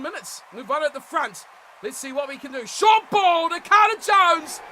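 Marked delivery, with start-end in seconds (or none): 2.7-4.6 excited call. Real, not acted. Calling a break.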